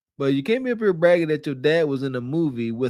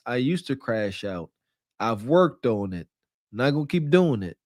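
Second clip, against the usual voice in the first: remorseful; 6 semitones lower than usual. Shown as a demonstration. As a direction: sound sorry for it, head down. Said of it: "No, you're supposed to say".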